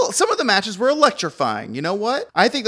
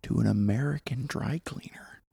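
whisper; 5 semitones lower, 9 dB quieter than usual. Pretend. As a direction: draw the secret out in a whisper. Trading whispered rumors.

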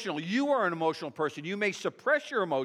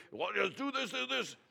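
mock nagging parent; 2 semitones higher, 6 dB quieter than usual. Parent telling kids the same old thing, mock nagging. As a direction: imitate a parent pestering the kids.

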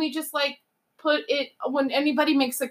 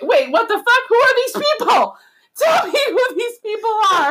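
loud, high outraged demand; outraged yelling; 9 semitones higher, 4 dB louder than usual. Real, not acted. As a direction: yell it in disbelief. Outraged rant, cracking up.

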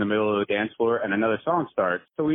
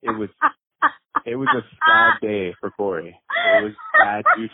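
sudden loud hammed-up lament; mock dramatic wail; 11 semitones higher, 5 dB louder than usual. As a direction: wail it like a tragedy. Mock lament about being rejected.

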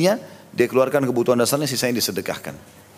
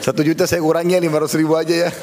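joking while laughing, a bit louder; 5 semitones higher, 3 dB louder than usual. Real, not acted. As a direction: chuckle through the mock bargaining. Bercanda sambil tertawa. (bicara sambil tertawa)

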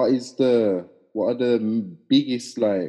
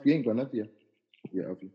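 ordinary delivery

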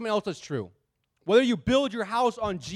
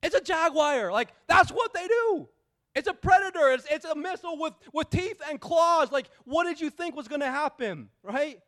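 incredulous rant; 6 semitones higher than usual. Retold or imitated. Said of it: "And I'm like".

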